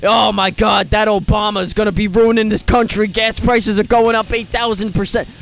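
hammed-up outrage over gas prices; mock outraged voter. Mimicking outraged voters six years ago, hammed-up panic. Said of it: "Think six years ago".

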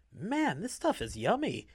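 delighted. A narrator's reading. Inmate enjoys food.

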